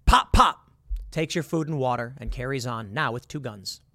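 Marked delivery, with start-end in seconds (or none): 0.1-0.7 two loud, high popped syllables; imitated gunshots. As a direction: pop out two sudden shots.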